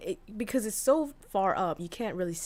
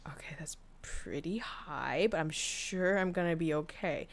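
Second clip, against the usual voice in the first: softer whisper of someone scared of heights; nervous whisper; 3 semitones lower, 6 dB quieter than usual. Retold or imitated. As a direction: hush it to yourself, talking yourself into it. Nervous inner voice atop tree.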